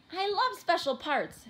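high, loud, eager exclamation; thrilled child. A narrator's reading. Chico hearing of his special part, thrilled. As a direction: burst out with childlike delight.

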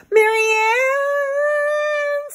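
very high, long drawn-out wail. A narrator's reading. Susan sees her doll carried downstream, wailing. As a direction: stretch the name into a loud, high wail. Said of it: "Susan wailed".

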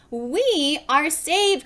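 high, slow exclamation; storybook cry of rescue; relieved cry. A narrator's reading. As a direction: draw it out high, crying out in relief. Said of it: "cried Missus Watson".

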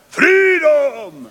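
high, loud rallying cry; mock heroic war cry; Braveheart battle cry. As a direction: bellow it like a warrior charging into battle.

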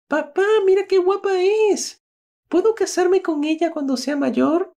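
little son asking his father, high and eager; child's voice. Acted. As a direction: chirp it like a little boy smitten with the nanny. Little son Joaquín speaks.

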